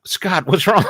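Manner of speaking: high, loud sudden worry; alarmed call. Retold or imitated. Recalls seeing his coworker slump, calls his name in alarm. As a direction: call out to a friend who just dropped.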